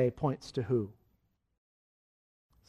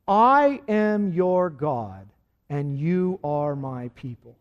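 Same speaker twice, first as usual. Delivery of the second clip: slow, deliberate call for repetition; slow leading recital; much slower than usual. Shown as a demonstration. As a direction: say each word slowly for the congregation to echo. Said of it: "repeat this after me right now".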